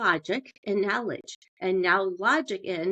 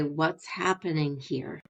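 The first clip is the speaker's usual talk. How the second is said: slow, calm curious question; softly curious, taking your time; 2 semitones lower, 4 dB quieter, slower than usual. Shown as a demonstration. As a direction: ask it slowly and gently. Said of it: "And you can hear me, I'm slowing down".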